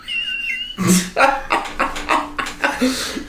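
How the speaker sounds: high, breaking giggles; real laughter. Real, not acted. Players amused by the cheap child, high laughing.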